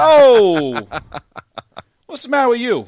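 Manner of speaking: put-on loud, high New York accent; New York outrage. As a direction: burst out like an offended New York wise guy. Offended mob guy outburst in accent.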